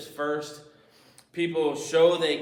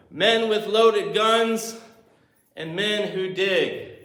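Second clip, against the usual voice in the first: slow drawn quote; 3 semitones higher, 4 dB louder, slower than usual. Retold or imitated. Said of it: "He says there are two kinds of people".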